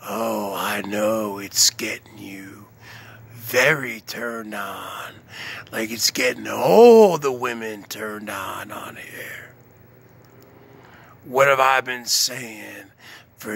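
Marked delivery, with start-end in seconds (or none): 0.1-9.5 breathy seductive whisper, no voice; sultry whisper. Pretend. Whispering to Lindy to seduce her, sultry. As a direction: whisper it to turn them on. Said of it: "Listen to my, my sultry, smoky voice".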